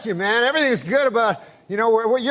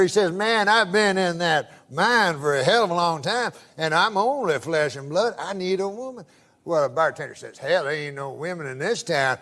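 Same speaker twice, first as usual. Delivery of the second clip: put-on low, drawling Southern old-timer voice telling a joke; Southern accent; 4 semitones lower than usual. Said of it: "I can tell by the accent".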